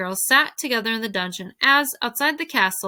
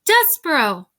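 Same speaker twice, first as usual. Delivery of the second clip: high, loud call of his name; shout; 6 semitones higher, 6 dB louder than usual. A narrator's reading. The princess calls the name of the one she loves, shouting. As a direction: shout "Despereaux" out to him. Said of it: "then she shouted it".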